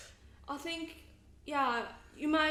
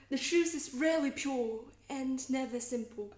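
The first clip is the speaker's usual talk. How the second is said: posh British stage accent, line run together; posh British theatre accent; 2 semitones higher than usual. Shown as a demonstration. Actor repeats a favorite line for the host, posh British accent. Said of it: "show you in the posh British theatre accent".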